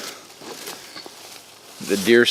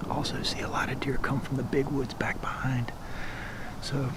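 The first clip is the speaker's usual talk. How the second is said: hushed hunting whisper; 4 semitones higher, 11 dB quieter than usual. Real, not acted. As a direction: whisper so the deer won't hear. Hunter whispering in the blind.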